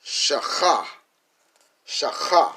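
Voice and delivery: "Shacha" as SHA plus a rasped KHA; teacher pronouncing "Shacha" emphatically; syllables put together. Shown as a demonstration. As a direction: sound out "Shacha" high and loud, rasping the CH. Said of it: "if we put that together".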